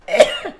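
high-pitched sick cough; squeaky cough. Real, not acted. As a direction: cough from a cold.

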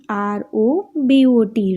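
letters spelt out slowly one by one; slow letter-by-letter spelling. Shown as a demonstration. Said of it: "તેમાં ત્રણ spelling છે".

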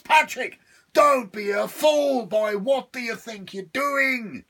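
loud, high, slow devil yell from inside a box; devil shouting. Acted. Trapped devil shouting at Patrick from the box. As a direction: bellow through the lid. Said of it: "could still be heard shouting inside the box".

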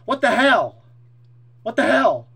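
loud, indignant what the hell; outraged shout. Retold or imitated. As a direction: shout as if swarmed again. Retelling being swarmed by pigmen.

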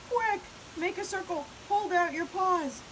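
high, urgent orders; yelling. A narrator's reading. Pip organising the catch, yelling urgently. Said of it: "yelled Pip".

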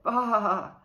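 loud bleating baa; lamb bleat. A narrator's reading. Scared lamb bleating while waiting for the shepherd.

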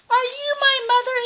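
high, quick little bird question; baby bird voice. Acted. Grandma reading to James, the baby bird asking the kitten, high and quick. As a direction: ask it fast and high like a tiny chick. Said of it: "he said to the kitten".

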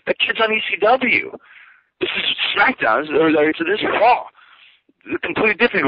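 indignant protest. Retold or imitated. Retold argument with boss.

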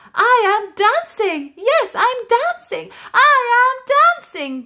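bursting with joy at last dancing; joyful cry. Acted. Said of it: "Gerald cried".

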